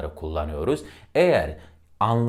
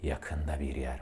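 yakında dropped low and soft; low and near; 6 semitones lower, 10 dB quieter than usual. Shown as a demonstration. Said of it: "Bakın tonlama yapmış oldum".

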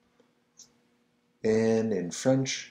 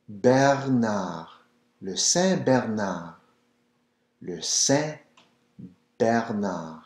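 usual speaking voice

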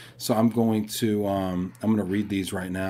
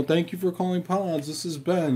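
mock phone-agent greeting, pitched higher; call center agent; 7 semitones higher than usual. Young call center agent greeting a caller, lightly voiced. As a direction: play the cheery headset agent. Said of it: "You know".

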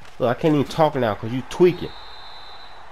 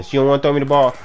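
ordinary talk

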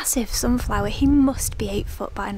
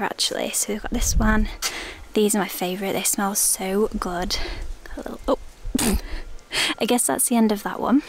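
normal talking voice

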